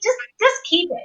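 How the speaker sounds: high-pitched retold protest; pleading refusal. Woman refusing the guitar from repairman, high protest.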